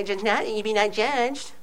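lightly mock the liberal reading, mushy and soft; a hint of a smug liberal. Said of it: "the way the liberals say".

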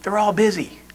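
hushed answer, giving nothing away; whispering child. Acted. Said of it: "Still whispering".

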